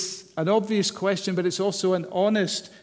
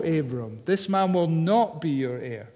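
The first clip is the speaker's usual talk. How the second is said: ordinary talk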